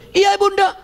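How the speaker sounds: high, loud call of Bunda; shouted reply. Retold or imitated. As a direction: shout the answer back. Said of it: "karena jawabannya teriak".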